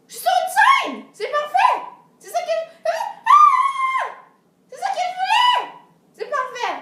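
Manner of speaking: high, loud delighted shouting; thrilled shout. Retold or imitated. Girl realizing she stands on a stage, ecstatic shout. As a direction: burst out with joy at the discovery.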